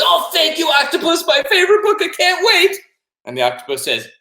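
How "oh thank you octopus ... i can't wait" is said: very high, loud excited gushing; thrilled little boy. Acted. Boy gets favorite book read, thrilled. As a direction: squeal it with bursting anticipation.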